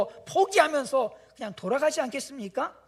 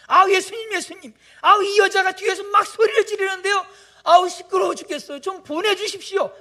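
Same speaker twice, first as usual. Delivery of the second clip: annoyed complaint; 9 semitones higher, 8 dB louder than usual. Retold or imitated. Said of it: "막 그냥 이렇게 얘기를 하는 거죠".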